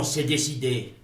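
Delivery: usual speaking voice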